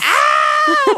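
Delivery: high shrieked gasp, then excited; mock shriek. Real, not acted. Reacting to lobotomy crimes topic.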